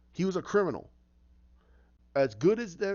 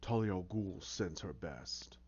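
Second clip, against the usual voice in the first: low, slow, ominous delivery; assassin's message; 9 semitones lower, 9 dB quieter, slower than usual. Retold or imitated. Assassin delivers Talia's message to Joker, low and ominous. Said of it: "And you hear".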